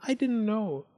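high, mildly sorry voice; sad Goldilocks. A narrator's reading. Goldilocks excusing herself to the bears, mildly sad. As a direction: plead ignorance, gently rueful. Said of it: "said Goldilocks sadly".

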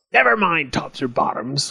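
indignant goblin outcry in a put-on voice; crying out, goblin. A narrator's reading. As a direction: cut the farmer off as a cross goblin. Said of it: "cried the goblin".

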